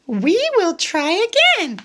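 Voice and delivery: high little pig voice; Piggy encouraging Gerald, high voice. Acted. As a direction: pitch way up, cheery little pig. Piggy's line.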